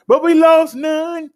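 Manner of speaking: high, loud and slow, "lost" stretched in delight; triumphant call. Acted. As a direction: crow it out like a victory. Triumphant punchline in stats report.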